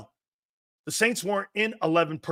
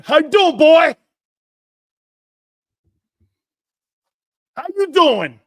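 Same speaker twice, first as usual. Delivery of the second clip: high, loud taunting yell; mock tough-guy holler; taunting shout; 10 semitones higher, 11 dB louder than usual. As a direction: bark it at the guy you just flattened. Imitating player taunting opponent.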